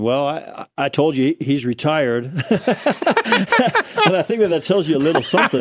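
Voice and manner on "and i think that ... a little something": chuckling through the words, a bit faster; laughing. Real, not acted. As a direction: laugh while making the dry joke. Joking that he retired.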